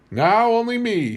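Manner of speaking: put-on high, loud frail old woman voice; old tribeswoman. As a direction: speak as the last of her tribe, high and old. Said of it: "she concluded".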